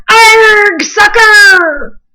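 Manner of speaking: high, loud pirate taunt; a captain's gloating pirate yell. A narrator's reading. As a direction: jeer at the ball with a big yell. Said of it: "kicked the ball and yelled".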